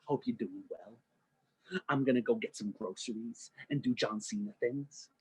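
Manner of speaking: a quiet, earnest good morning message; a hint of a sweet boyfriend; lightly voiced John Cena. Voicing John Cena's text.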